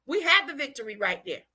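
fired-up preaching in punchy phrases. Real, not acted. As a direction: preach it to a responsive church. Preaches to responding congregation.

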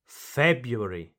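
February said FEB-yoo-air-ee; first R dropped. Shown as a demonstration. Teacher models pronunciation number two, February without its first R. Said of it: "you do not pronounce the first".

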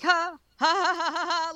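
high, hammed-up "ha ha" laugh; weird fake laugh. Fan imitating Superman's great laugh, high fake ha-ha. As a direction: imitate a big, deliberately phony laugh. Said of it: "weird fake la".

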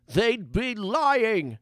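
put-on high, drawn-out old mage cry; scruffy old mage yelling. A narrator's reading. Old mage answers from the bush, high slow yell. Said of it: "yelled the scruffy old mage".

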